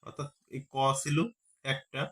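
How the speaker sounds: ordinary delivery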